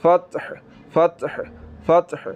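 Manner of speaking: फतह with a soft mid-throat ह; teacher modelling correct फतह. Shown as a demonstration. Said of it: "हलक के दरमियान वाले हिस्से से".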